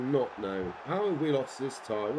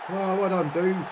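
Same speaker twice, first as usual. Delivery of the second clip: excited praise; 7 semitones higher, 6 dB louder than usual. Real, not acted. Celebrating penalty scored.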